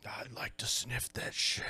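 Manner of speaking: breathy hushed aside; mock whisper. Girl sniffing the flare, mock whisper. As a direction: whisper it conspiratorially.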